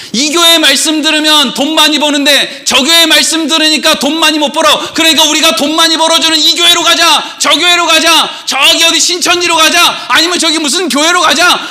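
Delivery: high, insistent mock rallying; clamouring churchgoers. Acts churchgoers chasing profit between churches.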